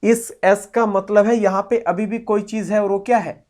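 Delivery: normal talking voice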